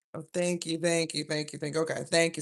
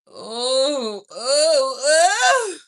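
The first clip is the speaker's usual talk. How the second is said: rising oh, each higher and louder; excited cry; 9 semitones higher, 12 dB louder, much slower than usual. Real, not acted. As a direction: swoon over the reading. Reacting to card reading.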